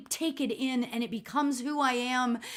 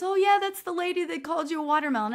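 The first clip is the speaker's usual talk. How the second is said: fast, higher, teasing imitation of the man at the pool; mocking man; 7 semitones higher, faster than usual. Man at the pool teases her about watermelon, fast mock voice. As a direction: toss it off quickly like a teasing stranger. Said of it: "he goes".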